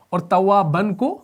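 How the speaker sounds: usual speaking voice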